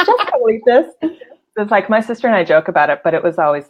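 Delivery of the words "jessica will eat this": hint of a mom serving seconds at dinner; mom's voice. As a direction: daughter mimicking her mom at the dinner table, lightly. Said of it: "my mom always gave me the seconds".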